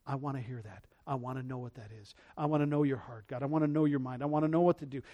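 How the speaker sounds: very soft, low, earnest murmur; hushed prayer. Retold or imitated. Preacher voicing his private longing to God, hushed. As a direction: drop right down and pray it privately.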